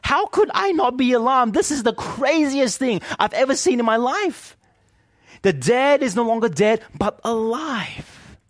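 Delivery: voicing the listener's amazed protest; incredulous disbelief. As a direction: play the stunned skeptic who can't believe it. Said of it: "And we think".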